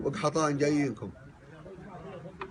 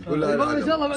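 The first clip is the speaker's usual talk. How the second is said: high, raised calling voice; loud call; 11 semitones higher, 4 dB louder than usual. Real, not acted. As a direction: call out over the crowd. Calling the crowd to calm.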